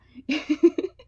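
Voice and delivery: bursts of real laughter; laughing. Real, not acted. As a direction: laugh loudly at the memory. Laughs at own anecdote.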